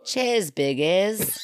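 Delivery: slow, hammed-up Australian accent. Shown as a demonstration. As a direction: drag it out like a cheeky Aussie. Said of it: "was that not Australian accent?".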